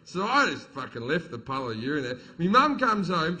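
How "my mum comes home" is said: ordinary talk, nothing marked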